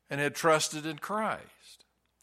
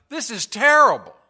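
raised, anguished plea; a sinner admitting pain to God, raised and anguished; pained cry to God; 6 semitones higher, 9 dB louder than usual. Pretend.

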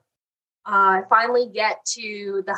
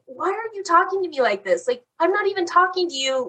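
higher, indignant retort at the clerk; frustrated comeback; 7 semitones higher than usual. Retold or imitated. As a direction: fire back, exasperated but not shouting. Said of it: "I went back at her".

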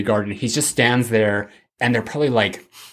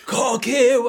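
failed Loomis voice; 12 semitones higher than usual. Retold or imitated. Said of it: "I can't even do the voice".

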